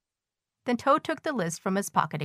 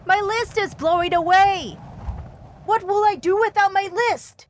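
high, panicked wail; high-pitched, frantic lament; distressed cry; 13 semitones higher, 4 dB louder than usual. A narrator's reading. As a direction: cry out in alarm over a loss. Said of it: "cried Toad".

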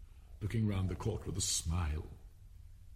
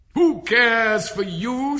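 high, drawn-out, defiant shout; loud defiance; 13 semitones higher, 13 dB louder, much slower than usual. A narrator's reading. Alice at full size facing the Queen, loudly defiant. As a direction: throw it at them fearlessly.

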